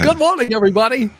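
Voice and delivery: high, bright smiling radio greeting; imitating a cheery morning radio host; radio boss voice. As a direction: beam it out like an old-school DJ. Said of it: "I had a boss that used to talk like that".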